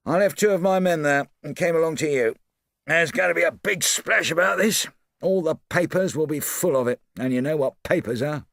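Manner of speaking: a hint of a bluff Scotland Yard man; Japp's voice. A narrator's reading. Japp briefing Poirot, brisk and bluff.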